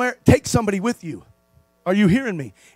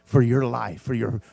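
hushed pastoral appeal; 9 semitones lower, 5 dB quieter than usual. Acted. Pastor pleading softly with his flock to get close to God. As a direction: draw the congregation close, gently.

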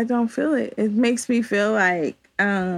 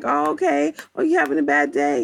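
higher, gentle soothing voice; comforting; 6 semitones higher than usual. Retold or imitated. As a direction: soothe someone having a bad day. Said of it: "that was just like".

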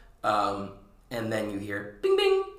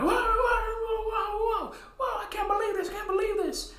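much higher squeaky disbelief; panicked clown. Acted. Clowns freaking out after Jester falls, high squeaky whoas. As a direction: squeak out stunned cartoon panic.

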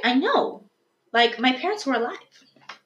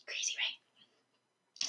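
stage whisper; 19 dB quieter, much slower than usual. Real, not acted. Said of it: "stage whisper aside".

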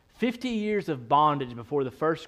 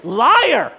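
louder, a pointed shout of Liar!; mock accusing shout; 6 semitones higher, 10 dB louder than usual. Preacher teasing happy couples with a loud shout of Liar!. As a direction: call them out, playful and loud. Calling out the congregation.

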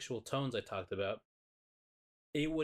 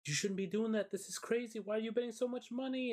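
high, pleading worried voice; lightly voiced anxious friend; 12 semitones higher than usual. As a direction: fret at her betting.

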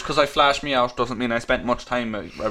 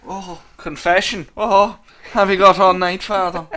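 hammy confession voice; 6 semitones higher, 5 dB louder than usual. Acted. Mock confession reply.

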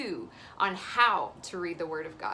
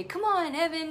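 put-on high, loud child calling his buddy; imitating a small child's excited call; eager little boy; 8 semitones higher, 4 dB louder than usual. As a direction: pitch it up like a kid inviting a playmate. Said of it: "arms around each other and say".

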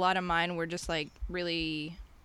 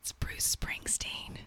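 whispered confession; 7 dB quieter, slower than usual. Real, not acted. Confessing an unpopular opinion.

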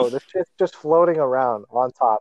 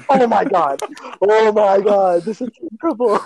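acted horror; 5 semitones higher than usual. Storyteller relives overhearing his parents, horrified. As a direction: replay the dread, hammed-up and high.

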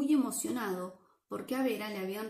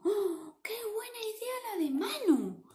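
high, bright cry of delight at the idea; a big, excited storyteller's cheer; delighted exclamation; 11 semitones higher than usual. Acted. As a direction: gush with delight to the children. Excited reader praises idea.

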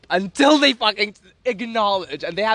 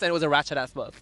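usual speaking voice

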